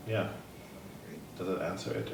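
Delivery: ordinary delivery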